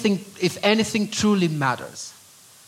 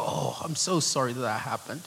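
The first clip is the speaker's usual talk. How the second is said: lower, hushed retold apology; soft apology; 4 semitones lower, 9 dB quieter than usual. Pastor apologizing to people hurt at church, soft and low. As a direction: say sorry softly, as if to someone hurt.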